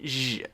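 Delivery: held voiced "zh"; drawn-out "zh" alone; ZH sound. Shown as a demonstration. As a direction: buzz "zh" like sh with voice. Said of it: "It's that sound".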